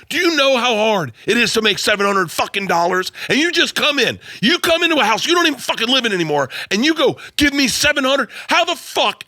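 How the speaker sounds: high-pitched imitation of an exploding dad; retold angry rant. Dad to broke son asking for ski money, retold outraged rant. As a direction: act out dad losing it, pitch way up.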